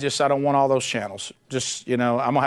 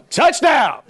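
loud, high mock touchdown cheer; a preacher playing Satan calls the touchdown; 10 semitones higher, 5 dB louder than usual. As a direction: call it like a sportscaster celebrating a score. Satan scoring, mock play-by-play.

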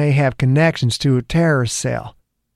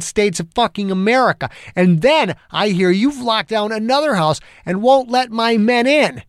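building heated cop rant, pitched up; angry rant; 8 semitones higher than usual. A narrator's reading. Angry detective venting at the federal agent. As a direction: vent the whole bad day at him.

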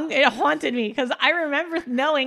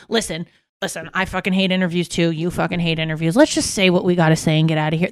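lower, gruff mock producer; bad cop producer; 7 semitones lower than usual. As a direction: play the weary bad cop, low. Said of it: "they were like".

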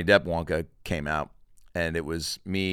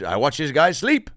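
louder, higher smug confession; creepy shopkeeper; 6 semitones higher, 10 dB louder than usual. Retold or imitated. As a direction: admit it proudly like a weird candy man. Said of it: "the guy".

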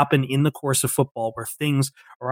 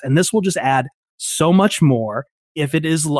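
ordinary delivery

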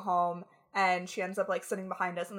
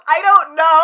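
clueless reply; 7 semitones higher, 16 dB louder than usual. Retold or imitated. Zoe's clueless answer retold.

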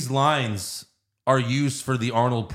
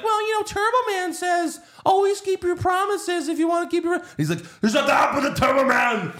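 much higher, whiny then fed up; kid voice then Arnold. Retold or imitated. Podcaster acts out Jamie and Arnold arguing on the phone, high voice. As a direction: whine it, then snap back. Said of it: "Jamie's just like".

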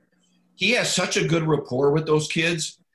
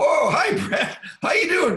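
mock cheerful greeting; 12 semitones higher than usual. Retold first call from Brett.

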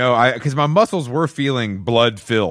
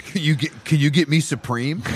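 put-on eager young cousin; 5 semitones higher than usual. Cousin voiced asking favor.

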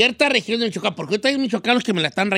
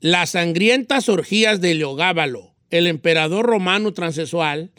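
lower, controlled neutral Spanish accent; 5 semitones lower than usual. Shown as a demonstration. Pupil trying again after correction, neutral accent. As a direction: read in a neutral accent, no singsong endings. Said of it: "no puede terminar cantado".

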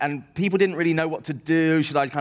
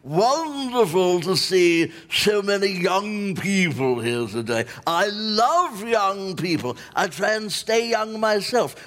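put-on old theatrical lovey; 7 semitones higher than usual. Old raconteur greeting a young club audience, hammed-up theatrical lovey. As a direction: hold court grandly, stretching the key words, rising to a high payoff. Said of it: "he's this old theatrical lovey".

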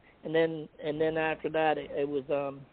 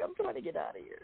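much higher and softer, a frantic little squeak; panicked squeak; 14 semitones higher, 10 dB quieter than usual. Retold or imitated. Sneaking out before intermission, high soft panicked squeak. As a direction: sound desperate to slip away unseen. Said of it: "I'm like".

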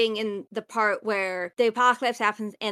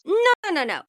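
eager correction; 9 semitones higher, 4 dB louder, much faster than usual. Acted. Correcting a misunderstanding.

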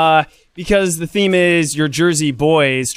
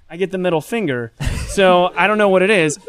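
ordinary delivery

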